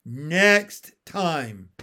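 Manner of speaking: emphatic, falling turtle warning; threat repeated. A narrator's reading. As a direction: drive home the threat.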